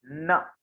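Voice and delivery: न with tongue at the teeth, through the nose; tongue to teeth for न, nasal; nasal letter demo. Shown as a demonstration. Teacher showing students dental nasal न. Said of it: "दंत और नासिका के प्रयोग से".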